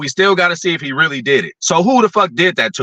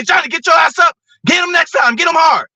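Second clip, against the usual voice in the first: tough coach yelling; 12 semitones higher than usual. Retold or imitated. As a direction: bark it like a hard-nosed coach. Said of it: "I want him to say".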